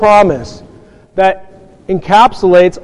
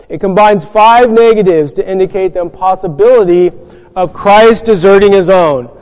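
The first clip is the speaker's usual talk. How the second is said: normal talking voice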